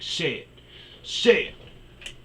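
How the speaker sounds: high, loud demand, mimicking the ex; re-enacted shouted taunt; imitated goading. Ex goading him, retold.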